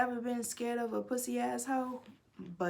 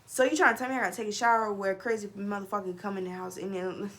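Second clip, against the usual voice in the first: ordinary talk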